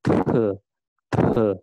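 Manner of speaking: "the" with a strong airy T release; aspirated T. Shown as a demonstration. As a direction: puff air out on each "the". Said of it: "Jadi ada udaranya ya". ("the" dengan udara yang dihembuskan)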